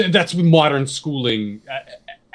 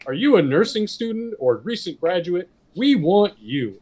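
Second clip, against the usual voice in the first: higher-pitched mock recruiter, slowing on the last words; recruitment ad pitch; 7 semitones higher than usual. Ad person recruiting Canadian nursing students, lightly mocked recruiter pitch. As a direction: lightly imitate an ad's eager appeal to nurses. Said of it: "holding a Canadian flag and be like".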